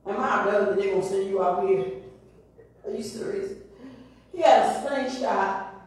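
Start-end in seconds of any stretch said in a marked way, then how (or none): 0.1-3.5 lower, softer scoffing giant; Goliath scoffing. Acted. Preacher voices Goliath mocking young David, lower and softer. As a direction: sneer at the little boy in disbelief. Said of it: "Goliath looked at David like".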